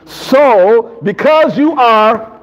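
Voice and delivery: slow, loud prompt for the congregation; drawn-out cue. Shown as a demonstration. Preacher cueing congregation to finish the verse, slow and emphatic. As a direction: stretch each word, leaving the end open. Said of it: "say this like everybody got a Bible".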